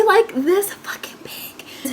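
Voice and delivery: high squeezed voice dropping to a whisper; hushed aside. Real, not acted. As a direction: draw it out, ending in an emphatic whisper. Showing how tiny the roach was.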